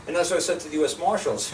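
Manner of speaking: ordinary talk, nothing marked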